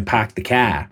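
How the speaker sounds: Boston accent with no r: "Park the car" as pahk the cah. Shown as a demonstration. Telling a Boston story, he shows how Bostonians say park the car in a Boston accent. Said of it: "in Boston, they say".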